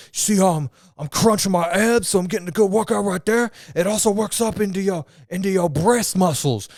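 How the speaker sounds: loud, high hammed-up coaching voice; mock gym guy demo. Storyteller acting the gym guy coaching them, loud mock coach voice. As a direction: play the stranger demonstrating, loudly. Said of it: "he's like".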